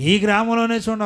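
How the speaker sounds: high, loud, emphatic pulpit voice; full-force preaching. Acted. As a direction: drive each phrase home.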